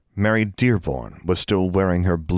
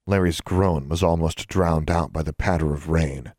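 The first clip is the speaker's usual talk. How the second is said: plain reading voice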